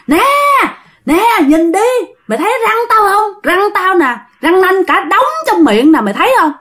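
high, taunting ghost voice; menacing demon. Acted. Demon shows its fangs to the uncle, high menacing taunt. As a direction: dare them to look, pitched well up.

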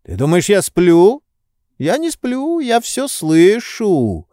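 high-pitched, drawn-out protest; indignant insistence. A narrator's reading. Drowsy master protests to servant, high indignant insistence. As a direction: insist in a high voice, slowing at the end.

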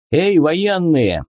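officer's sudden, slow-stretched shout. A narrator's reading. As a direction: call out to passing soldiers. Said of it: "неожиданно повысил голос прапорщик".

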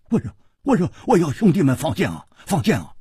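high, hurried answer under attack; flustered pleading. Acted. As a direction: blurt it out, pleading and repeating yourself. Beaten man pleads with angry boss.

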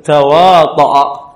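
تواطأ said slowly with a clear final a; slow demo word. Shown as a demonstration. Teacher contrasting word endings for the class, slow and deliberate. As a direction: draw out تواطأ so they hear the fatha. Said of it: "تقول تواطأ العلماء على هذا القول".

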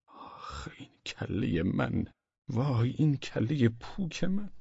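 soft, slow groaning regret; rueful lament. Acted. Husband curses his own empty head, rueful and soft. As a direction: sigh it out, drawn out and quiet.

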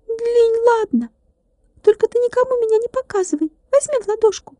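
a little glass man forgiving, a bit hushed; reconciled tiny glass man. A narrator's reading. Tilka makes peace. (примирившийся стеклянный человечек, тонко, тише и быстро)

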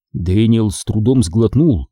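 ordinary delivery, nothing marked

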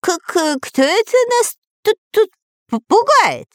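very high, stammering panic; crazed with fear. A narrator's reading. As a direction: stutter it in a terrified squeal. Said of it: "обезумевшим голосом закричал Дырка".

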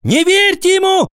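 put-on very high, frantic boy's shout; shrieked schoolboy protest. A narrator's reading. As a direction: cry out urgently, very high. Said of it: "закричал Алик".

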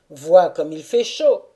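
a hint of a gruff father coaxing; Taras urging. A narrator's reading. Taras telling the dancer to take off his coat, loudly. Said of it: "lui dit enfin Taras".

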